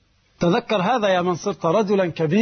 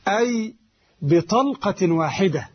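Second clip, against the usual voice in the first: ordinary delivery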